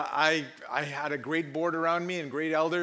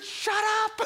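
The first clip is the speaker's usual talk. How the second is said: high, loud comic cut-off; hammed-up exasperated shout; mock-angry shout; 5 dB louder than usual. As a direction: shut him down with a yelp. Cuts off usher's doubt.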